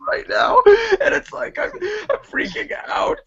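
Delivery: very high, squealing fake crying; mock tearful meltdown. Upset guest pleading with a bullying host, in mock hysterical tears. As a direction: wail as if freaking out, hammed-up.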